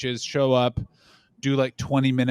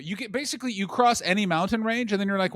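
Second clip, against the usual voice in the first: ordinary delivery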